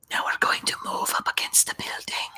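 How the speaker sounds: soft unvoiced whisper; leaning in to whisper. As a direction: whisper the plan carefully. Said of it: "motion very carefully with whisper voice".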